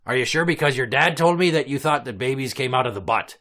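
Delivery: ordinary talk, nothing marked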